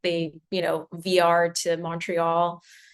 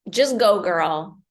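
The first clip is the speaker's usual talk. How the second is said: higher, louder urging; pep talk shouting go go go; excited cheering on; 4 semitones higher, 6 dB louder than usual. Pretend. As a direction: cheer her on like a best friend. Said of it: "what I would love for her to do is, like".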